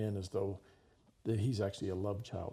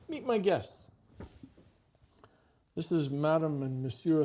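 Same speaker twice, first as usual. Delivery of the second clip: much higher, sugary hostess tone; sweet hostess voice; 5 semitones higher, 5 dB louder than usual. Retold or imitated. Madame Chameau presenting the boy to his disguised parents, sugary and high. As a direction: coax the boy sweetly in front of guests.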